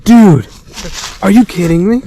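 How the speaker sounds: loud, high amazed exclamations; excited disbelief. Real, not acted. Hunter seeing the turkey's huge spurs, loud amazed shouting. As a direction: shout it out, floored by the spur.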